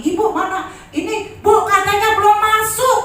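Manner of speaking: lightly hammed-up phone caller; restaurant caller. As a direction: play the insistent restaurant staff. Caller says payment not received.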